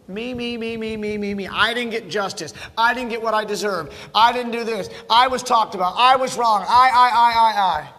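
escalating self-absorbed whine; self-centred complaining. Acted. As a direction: hammer every "I" in mock grievance. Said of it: "they're all inward".

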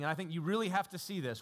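ordinary talk, nothing marked

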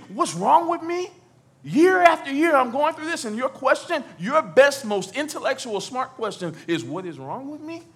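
high, incredulous mock outburst; indignant retort. Sufferer answering a clueless question, acted indignation. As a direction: throw the question back, high and loud, then ease off. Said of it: "that's how people say".

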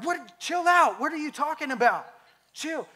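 high-pitched, indignant retort; acted annoyance. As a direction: snap back after a scare, played for laughs. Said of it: "I got mad".

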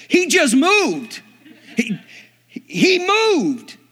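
high, slow, loud shocked cry; astonished shout. Retold or imitated. Preacher realising dead man moved, shocked loud cry. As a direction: yell it out in disbelief.

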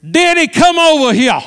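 high-pitched holler in her voice; calling out. Retold or imitated. Woman calling pastor over in church, hollering. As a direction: imitate a woman summoning someone across church. Vanessa calls him across church.

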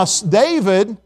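normal talking voice

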